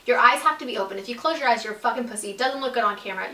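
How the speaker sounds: normal talking voice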